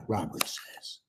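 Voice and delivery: ordinary delivery, nothing marked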